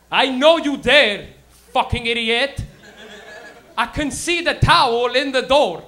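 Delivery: slow, loud father voice; imitated dad. Dad outside the door to smoking son, slow and loud. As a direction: lay it out slowly and loudly as a threatening dad. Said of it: "If my dad caught me smoking weed".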